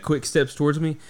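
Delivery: ordinary delivery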